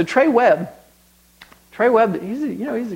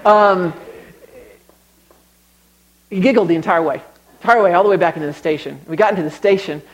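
ordinary talk, nothing marked

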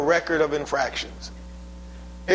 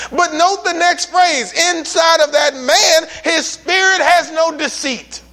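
higher and louder scripture proclamation; full-force preaching; 7 semitones higher, 4 dB louder than usual. Real, not acted. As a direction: proclaim the verses, climbing.

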